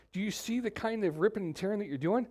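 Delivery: ordinary delivery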